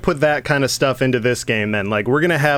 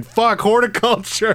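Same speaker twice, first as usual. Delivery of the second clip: high, drawn-out yell of frustration; imitating an exasperated player screaming; mock scream; 9 semitones higher than usual. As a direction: cry it out slowly in hammed-up rage. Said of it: "you're just screaming".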